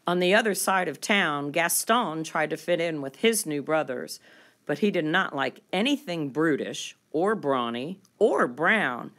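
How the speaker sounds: usual speaking voice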